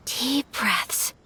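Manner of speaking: hushed, breathy pep talk to herself; whispered self-calming. Acted. Ayaka whispers to herself before inviting Traveler, nervous and hushed. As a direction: whisper to steady your own nerves. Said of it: "Deep breaths, Ayaka".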